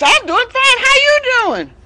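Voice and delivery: high, loud friendly reply; friendly fox. Acted. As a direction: answer brightly as a sly fox. Storyteller voices the fox.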